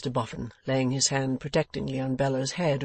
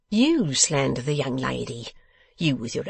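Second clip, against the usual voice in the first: quicker retort in a put-on voice; scornful echo; 4 dB louder than usual. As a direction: throw the accusation back at him. Boffin throws the protest back.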